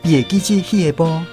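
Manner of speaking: usual speaking voice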